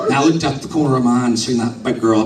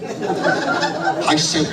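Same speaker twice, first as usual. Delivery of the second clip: laughing; 5 semitones higher than usual. Real, not acted. Teller laughing mid-joke.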